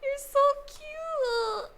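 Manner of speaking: slow, very high cooing; cutesy aww. Real, not acted. Touched by friend's sweetness.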